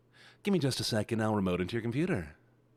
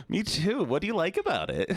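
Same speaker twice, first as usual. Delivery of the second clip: high, delighted and flattered; mock flattered gasp; 9 semitones higher than usual. She plays herself flattered by the agent's compliment, high and giddy. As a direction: play up being charmed, with a laugh. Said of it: "I'm like".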